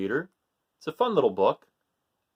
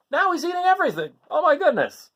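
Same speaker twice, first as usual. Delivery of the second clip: surprised; 12 semitones higher, 4 dB louder than usual. Real, not acted. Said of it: "narrator's surprised aside".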